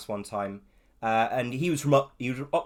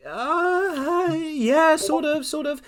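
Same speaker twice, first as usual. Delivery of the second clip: high, hesitant, mumbled reply; sheepish younger self; 7 dB louder, much slower than usual. Retold or imitated. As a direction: squeak it out like an unsure kid. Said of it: "And I'm like".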